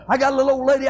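normal talking voice

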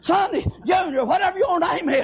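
high, driving shouted preaching; full-force preaching. Real, not acted. Preacher exhorting his congregation, full-force shouted preaching.

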